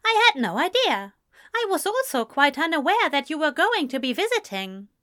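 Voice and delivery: high, quick, politely surprised Englishman; flustered polite Englishman. Acted. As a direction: caught off guard, speak up briskly. Englishman's reply in story.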